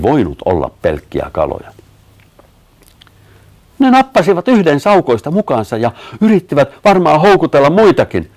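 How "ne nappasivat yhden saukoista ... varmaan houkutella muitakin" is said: high, loud outcry; alarmed shout. A narrator's reading. Sanni warns that an otter has been snatched, alarmed shout. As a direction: cry out the alarm, pitched high. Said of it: "Sanni huusi".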